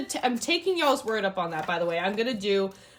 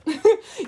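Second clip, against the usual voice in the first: bright burst of laughter; excited laugh; 12 semitones higher, 8 dB louder than usual. Real, not acted. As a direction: laugh out loud with delight. Excited about YouTube congratulations.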